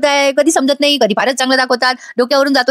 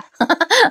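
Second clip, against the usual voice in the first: high laugh; 7 semitones higher than usual. Real, not acted. Narrator laughs at the memory.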